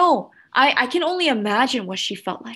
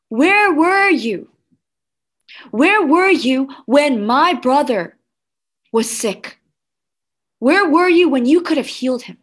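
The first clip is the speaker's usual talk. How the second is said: Mary's reproach; 2 semitones higher, 6 dB louder than usual. Acted. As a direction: plead loudly, demanding an answer from Jesus. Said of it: "Mary came to Jesus saying".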